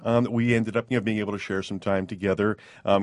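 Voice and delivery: ordinary delivery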